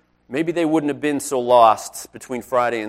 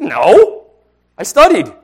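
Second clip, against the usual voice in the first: put-on louder and higher, an indignant boy's denial; 6 semitones higher, 10 dB louder, slower than usual. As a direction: protest your innocence to the suspicious teacher. Boy defending himself to teacher.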